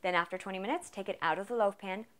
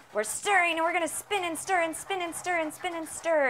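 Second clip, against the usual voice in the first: excited singsong; 8 semitones higher, 4 dB louder than usual. Real, not acted. Singsong stirring chant.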